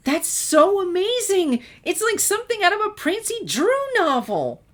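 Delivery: very high, loud, thrilled squeal; bouncing excitement. Acted. Twilight gushes to Sunset about Prancy Drew, squealing. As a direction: bounce on your toes and gush. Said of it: "practically bouncing on the balls".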